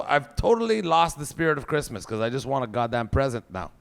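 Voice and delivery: ordinary talk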